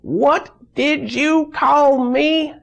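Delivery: hammed-up nurse yelling at a patient; indignant yell. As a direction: shriek it back, offended and furious. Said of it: "just yells".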